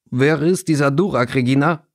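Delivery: put-on older boy with a thick Durmstrang accent; heavy Durmstrang accent. A narrator's reading. Older boy questioning Regina about a rival, Durmstrang accent. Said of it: "ältere Jungenstimme mit schwerem Durmstrang-Akzent".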